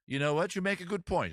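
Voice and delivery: high, loud British concession; borrowed British accent. Acted. Man agreeing with the seagull, British accent. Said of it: "suddenly taking on your accent".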